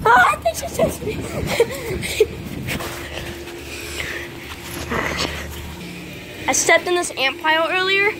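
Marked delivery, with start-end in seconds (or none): 0.1-2.4 high, slow, laughing through the words; laughing while chased. Real, not acted. Owner laughing as his dog chases him around the yard. 2.4-6.4 unvoiced, breathy giggling laugh; breathless laugh. Real, not acted. Owner laughing breathlessly as his dog chases him.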